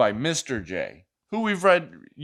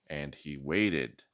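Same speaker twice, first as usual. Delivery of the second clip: slow and soft; 11 semitones lower, 9 dB quieter, slower than usual. A narrator's reading. Slow drawn-out waiting in story.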